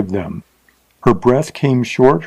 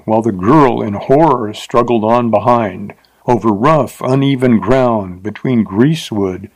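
usual speaking voice

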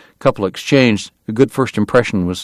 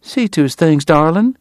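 higher-pitched Southern wife giving orders; high, brisk little command; sweetly ordering; 7 semitones higher than usual. A narrator's reading. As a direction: send the husband off in a high, ladylike voice. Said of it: "she ordered".

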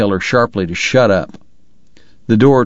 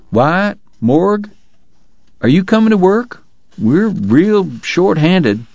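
higher Chuck voice; 10 semitones higher, slower than usual. Acted. Chuck asking for help.

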